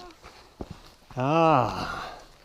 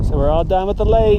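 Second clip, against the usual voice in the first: excited; 5 semitones higher, 10 dB louder than usual. Real, not acted. Announcing end of lake visit.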